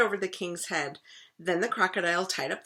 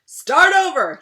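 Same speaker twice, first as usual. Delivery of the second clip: loud high shout; impatient king shouting; 9 semitones higher, 14 dB louder, slower than usual. Acted. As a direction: bellow the order. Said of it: "he shouted".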